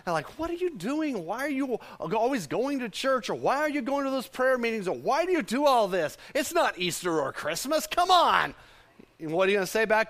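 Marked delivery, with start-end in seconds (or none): 0.4-8.5 incredulous, pitched-up nagging; a scoffer poking at someone's faith; questioning critic. Acted. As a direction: pester them with doubting questions. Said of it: "people who will question your sanity".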